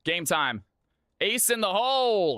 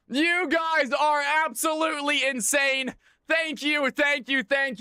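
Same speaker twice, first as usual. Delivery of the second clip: excited shout; 6 semitones higher than usual. Real, not acted. Thrilled by chat's subs.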